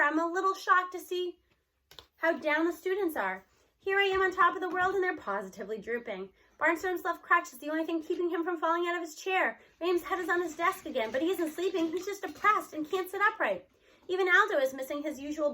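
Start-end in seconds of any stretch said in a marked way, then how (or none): none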